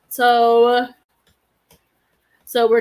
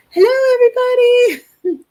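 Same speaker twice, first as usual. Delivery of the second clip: excited greeting; 13 semitones higher, 11 dB louder than usual. Real, not acted. Greeting live viewers.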